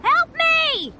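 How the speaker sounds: calling for help. Acted. Caged adventurer shouting out to unseen rescuers. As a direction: shout out hoping someone hears.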